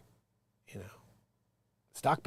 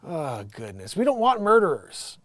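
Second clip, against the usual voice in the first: ordinary talk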